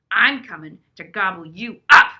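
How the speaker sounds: troll roar. Acted. Troll voiced in story.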